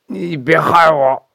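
put-on accusing old man, pleading; paranoid old father. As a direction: hold him off with suspicion.

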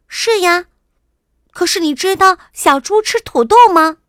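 put-on high, loud child's voice; excited princess. A narrator's reading. Princess telling her father about the pigs, excited. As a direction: pipe up loudly like an eager little girl.

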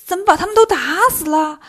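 acted flustered objection; hurried alarm. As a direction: cut in with alarm at what was done. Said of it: "急忙说".

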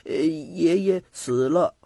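put-on slow childlike mumbling; murmuring child. A narrator's reading. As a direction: murmur it haltingly, like a child barely used to speaking. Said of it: "喃喃地说道".

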